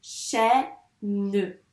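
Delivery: "Chaîne" split into chaî and a held ne; "Chaîne" with the final ne fully voiced; syllable by syllable. Shown as a demonstration. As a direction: slowly separate the two syllables of "Chaîne". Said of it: "On a bien deux syllabes".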